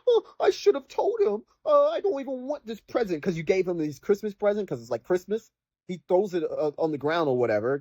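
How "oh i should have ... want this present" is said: high, mocking whine of a sulky kid; little brother sulking, done as a high mocking whine; whiny little brother. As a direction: mimic the bratty little brother.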